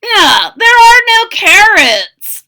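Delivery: high, loud, eager soldier voice. Acted. Second soldier agreeing about the carrots, high and loud. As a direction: pitch it up and push it out. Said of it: "agreed the second".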